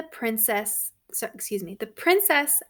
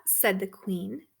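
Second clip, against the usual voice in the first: plain reading voice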